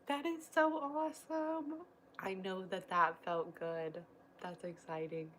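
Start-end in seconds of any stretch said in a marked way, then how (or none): 0.1-1.7 excited. Real, not acted. Reacting to a viewer's happy news.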